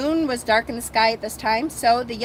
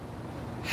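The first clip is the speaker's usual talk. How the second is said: soft breathy unvoiced sigh; a resigned sigh before answering; 16 dB quieter than usual. A narrator's reading. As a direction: let out a quiet weary sigh. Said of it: "And Vivian sighs".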